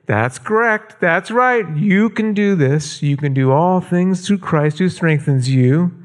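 mild grandpa voice, proud and encouraging. Retold or imitated. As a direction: cheer a little girl on gently. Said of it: "I want you to say that now".

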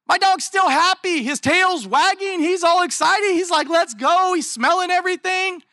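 a pastor beaming about his dog on walks; excited. Real, not acted. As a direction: bubble over about the happy dog.

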